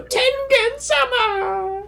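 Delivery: high, drawn-out wailing call; hammed-up slow wail; mock crying. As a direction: imitate a tearful cry of a name. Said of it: "Everyone's crying".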